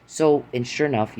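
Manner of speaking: usual speaking voice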